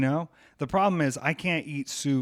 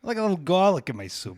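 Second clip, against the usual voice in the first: a hint of a vague put-on accent on "I like a little garlic in my soup", pitched up and louder; attempted accent; 4 semitones higher, 4 dB louder than usual. Shown as a demonstration. Teasing friend asks for an accent, so a faint mock accent. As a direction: lightly put on an unclear foreign accent. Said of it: "you're supposed to add a little accent to it".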